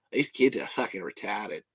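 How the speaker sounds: put-on Aussie accent, low-key; Australian accent. Shown as a demonstration. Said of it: "I'm gonna say this in an accent".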